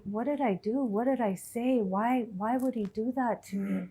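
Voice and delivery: soft, inward hurt questions; hurt self-questioning. Retold or imitated. Woman replaying her thoughts after the teacher's insult, softly hurt.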